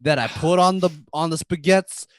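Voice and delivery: hammed-up New York Italian accent. Staten Island character boasts about chicken cutlets, New York Italian accent. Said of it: "with a New York Italian accent".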